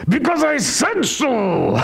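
high, hammed-up parental outburst; exasperated parent. Parent to kid who keeps asking why, exasperated. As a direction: snap it high, at the end of your patience. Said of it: "get you so exasperated".

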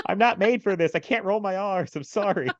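high, pleading retold distress; imitated helpless apology, pitched high; near breakdown. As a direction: plead like a pupil about to crack. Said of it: "Just about to break down".

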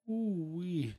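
high-pitched, drawn-out "ooh-wee"; mock amazed exclamation, sliding down from high; impressed whoop. Retold reaction to loud knock.

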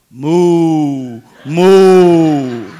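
loud drawn-out mooing; cow moo. Retold or imitated. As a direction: moo like a cow stuck in a tree. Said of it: "And he goes".

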